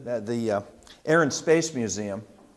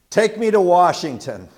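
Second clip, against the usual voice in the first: louder, higher retold demand from an old man; firm request; 4 semitones higher, 7 dB louder than usual. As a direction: quote him like a blunt order over the phone. Uncle's phone call demand quoted.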